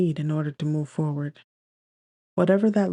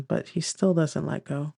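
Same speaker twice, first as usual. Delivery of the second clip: usual speaking voice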